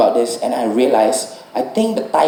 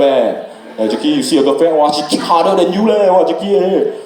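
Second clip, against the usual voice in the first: gym bros taunting; 3 semitones higher than usual. Retold or imitated. Fighters teasing Jackie at the gym. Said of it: "That's all they did".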